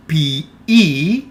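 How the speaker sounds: "P E" said carefully as two syllables, pee-EE; pronunciation demo. Shown as a demonstration. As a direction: sound out "P E" for a learner, ending on a long ee. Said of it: "pay attention to the pronunciation here".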